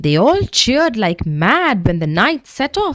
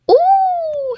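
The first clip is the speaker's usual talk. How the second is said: yelling as he falls. A narrator's reading. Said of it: "he yelled".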